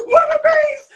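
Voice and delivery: a high, loud laughing shout of "Wallabies!". Retold or imitated. Retold yell while laughing.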